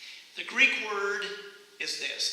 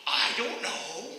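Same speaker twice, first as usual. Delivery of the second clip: put-on slow, higher-pitched boy's drawl; clueless boy; 5 semitones higher, slower than usual. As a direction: stretch it out like an unbothered kid. Acted country boy answering traveler.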